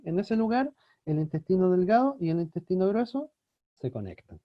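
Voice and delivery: ordinary talk, nothing marked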